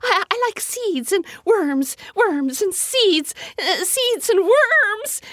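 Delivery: very high, stammering little bird. A narrator's reading. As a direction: chirp it out high and fluttery. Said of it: "she said to Winston".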